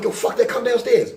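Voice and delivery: loud, high-pitched challenge; acted shout, voice shooting up; talking crazy. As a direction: holler up to call someone out. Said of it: "I'm talking crazy".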